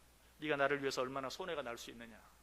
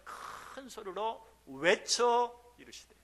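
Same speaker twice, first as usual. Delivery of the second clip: slow emphatic cry; 6 semitones higher, 4 dB louder, much slower than usual. Shown as a demonstration. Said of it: "예수님께서 어떻게 하셨어요?".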